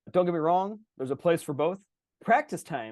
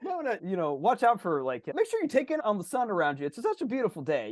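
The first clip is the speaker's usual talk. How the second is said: higher, chirpy unsolicited advice; hammed-up passenger nagging, pitched up; meddling backseat driver; 8 semitones higher than usual. Backseat passenger advising the driver, high and chatty.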